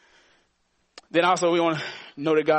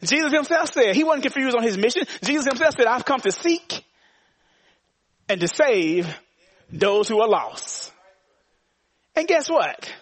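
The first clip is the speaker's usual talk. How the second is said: higher and louder, rising preacher's cadence; preaching at full force; 8 semitones higher, 3 dB louder than usual. Acted. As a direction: preach it to the back row. Preacher climbs again.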